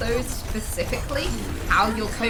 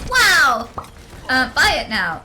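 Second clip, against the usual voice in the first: loud outburst; 3 semitones higher, 9 dB louder, slower than usual. Real, not acted. Sudden loud tic outburst.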